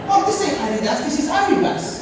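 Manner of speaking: high, laughing indignation; indignant. Retold or imitated. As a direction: protest to mum in disbelief. Complaining to mum.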